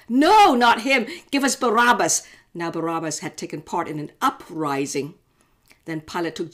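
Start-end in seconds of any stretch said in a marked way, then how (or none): 0.1-2.2 loud, higher crowd shout; crowd shouting back. Acted. The crowd to Pilate, a loud angry shout. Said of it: "They shouted back".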